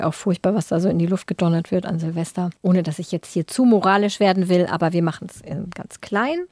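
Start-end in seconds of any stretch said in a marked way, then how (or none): none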